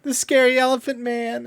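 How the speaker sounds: put-on high, tearful little girl about to bawl; a child's voice, squeaky and on the edge of crying; scared little girl. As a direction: play a frightened small child clinging to mom. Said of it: "grabs onto her mom and says".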